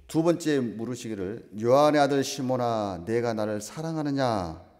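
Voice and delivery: loud, slow, declaiming the verse; a slow, loud Bible reading to the congregation; solemn scripture reading. Acted. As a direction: proclaim the scripture slowly from the pulpit.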